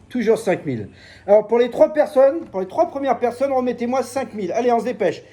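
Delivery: high, loud fairground sales patter; market hawker pitch. Shown as a demonstration. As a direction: sell it like a market barker.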